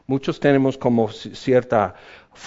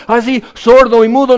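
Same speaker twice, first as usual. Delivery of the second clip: loud exasperated gasp; 11 semitones higher, 11 dB louder than usual. Pretend. Comic frustration.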